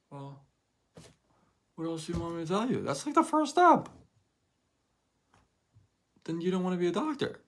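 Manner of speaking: fast incredulous rant at the excuse; exasperated. Real, not acted. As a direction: throw up your hands at the excuse.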